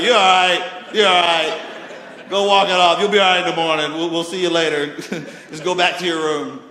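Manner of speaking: loud, brushing-off dad voice; joking tough father; offhand dad. Retold or imitated. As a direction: wave the kid away laughing. Dad voiced dismissing hurt kid.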